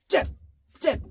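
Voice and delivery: short crisp 잽 cut off fast; punchy staccato call; quick jab. Shown as a demonstration. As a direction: jab and pull back. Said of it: "이겁니다".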